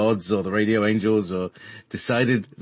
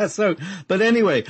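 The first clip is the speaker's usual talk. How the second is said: laughing; 10 semitones higher than usual. Real, not acted. Still laughing.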